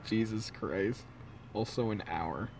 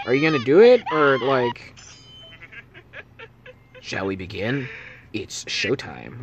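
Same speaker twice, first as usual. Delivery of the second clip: sinister villain; 3 semitones lower than usual. Acted. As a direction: whisper doom like a killer. Villain threatening death.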